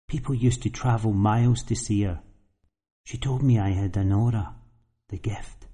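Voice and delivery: put-on quiet, hushed young woman; confiding softly, lightly voiced girl. Girl tells of her aunt.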